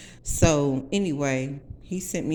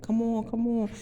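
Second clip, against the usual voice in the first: coaxing plea; 6 semitones higher than usual. Retold or imitated. Tony coaxing again.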